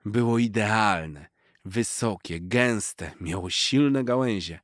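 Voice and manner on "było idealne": usual speaking voice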